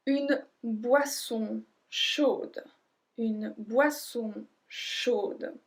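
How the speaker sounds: ordinary delivery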